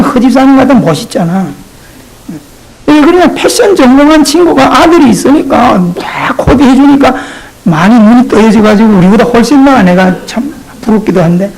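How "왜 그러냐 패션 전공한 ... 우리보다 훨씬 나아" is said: ordinary talk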